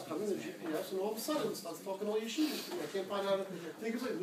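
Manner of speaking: ordinary talk, nothing marked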